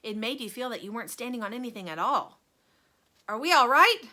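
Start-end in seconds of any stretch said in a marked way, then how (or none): 3.3-4.0 loud, high worry; anxious cry. A narrator's reading. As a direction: cry out nervously. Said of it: "cried Grandpa Joe".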